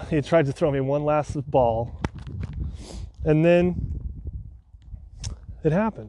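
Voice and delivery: usual speaking voice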